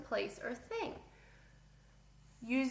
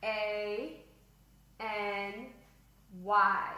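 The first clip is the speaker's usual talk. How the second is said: one letter at a time, long gaps between; letter-by-letter dictation; spelling out loud; much slower than usual. Shown as a demonstration. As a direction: spell it slowly for a class writing along. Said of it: "Spell it out loud".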